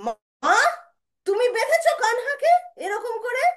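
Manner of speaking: high, loud, incredulous question; indignant accusation. Acted. Brother confronting his mother over tying Krishna, high indignant shout. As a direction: confront her in outrage.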